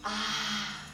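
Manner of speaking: plain reading voice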